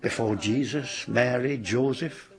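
ordinary delivery

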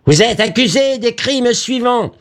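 very high, penetrating gramophone announcement; high-pitched relentless accusation; recorded accusing voice. A narrator's reading. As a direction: announce charges in a high, piercing formal voice. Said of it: "ils entendirent de nouveau".

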